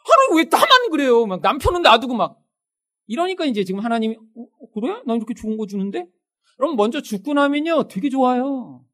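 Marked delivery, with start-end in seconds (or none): 0.0-1.2 high, loud mock whine; whiny protest. Believer complaining to God of being singled out, whiny. 4.1-6.1 hesitant, mildly taken aback; puzzled God. Acted. God puzzled at complaints about good gifts, bemused.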